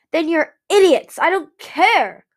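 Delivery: high, loud, slowed outburst; near shout. A narrator's reading. Winter nearly shouts at his friends to drive them away. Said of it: "Winter nearly shouted".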